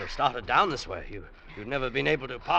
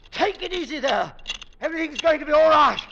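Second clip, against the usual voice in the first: raised, higher, steady comfort; reassuring call; 9 dB louder than usual. Acted. Rescuer reaching the hanging woman, raised reassuring call. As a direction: call down to calm her.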